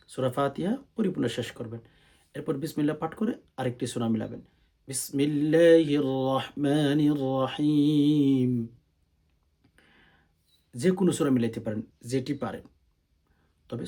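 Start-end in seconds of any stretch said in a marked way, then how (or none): 4.9-8.7 slow, louder, melodic tilawat; Quranic recitation. Shown as a demonstration. Imam teaching the Fajr prayer, chanting Fatiha slowly. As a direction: chant the Fatiha with long held vowels. Recites bismillah before surah.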